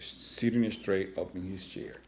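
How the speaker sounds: ordinary delivery, nothing marked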